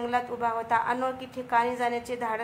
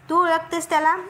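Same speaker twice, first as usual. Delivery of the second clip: higher and louder, in disbelief; surprised question; 5 semitones higher, 7 dB louder than usual. A narrator's reading. A friend asking Supri if she knows him, surprised and loud. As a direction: ask as if you can't believe it. Group member asks in disbelief.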